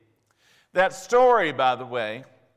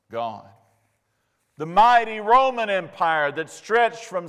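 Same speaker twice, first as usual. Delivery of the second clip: rising preaching; 3 semitones higher, 4 dB louder than usual. Acted. Preaching at full force.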